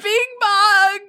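high, loud mock sob; mock wailing. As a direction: bawl the name out, hammed-up. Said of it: "both of us were like".

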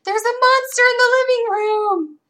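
put-on high, excited little girl calling out; imitating a child's scream. Daughter calling mom about a monster, high excited child voice. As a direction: squeal it like a small child spotting a monster. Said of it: "Lacey screams".

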